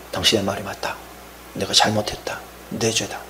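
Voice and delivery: broken confession. Retold or imitated. David admitting his sin to Nathan, hushed and broken. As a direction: confess it barely above a breath. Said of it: "통곡하며 회개합니다".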